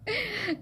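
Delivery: a quick high giggle; short laugh. Real, not acted. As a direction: laugh at your own joke. Laughing at own joke. (ಚಿಕ್ಕ ನಗು)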